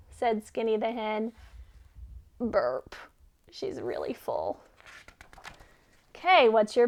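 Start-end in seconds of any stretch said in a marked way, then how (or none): 2.4-3.0 deep comic burp; low burp. Acted. Hen burps. 3.5-4.6 low comic aside. Acted. Aside after burp.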